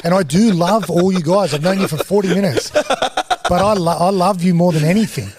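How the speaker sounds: overflowing with mock rehab emotion; half-laughing emotional gush. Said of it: "'Cause I'm crashing".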